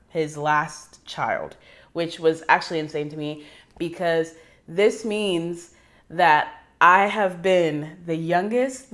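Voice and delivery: ordinary talk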